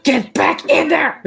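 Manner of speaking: high scolding call; mock scolding yell. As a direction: shout it at the books. Imagined person scolding books.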